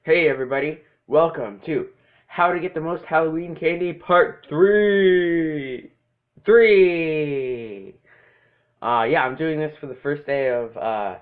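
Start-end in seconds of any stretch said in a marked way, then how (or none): 2.3-5.9 slow, high, loud announcer voice; spooky announcer. Acted. 6.4-7.9 loud, far announcer call; dramatic shout. Acted. Title number shouted in an echo.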